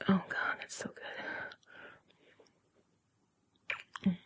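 breathy sigh of pleasure; hushed, swooning murmur; breathless pleasure. Acted. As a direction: let it fall away into a whisper. Acted passion during a kiss.

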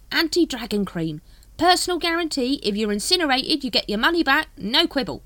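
high, loud cockney-ish hawker; street hawker voice. Acted. Dibbler greets the Captain, high hawker voice. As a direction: sidle up like a spiv.

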